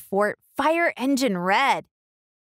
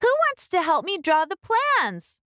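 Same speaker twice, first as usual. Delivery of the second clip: put-on higher, eager little girl asking; hopeful girl's request, pitched up; Ruby's voice; 11 semitones higher than usual. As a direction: ask brightly for a helper. Ruby asks again.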